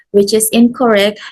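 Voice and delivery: usual speaking voice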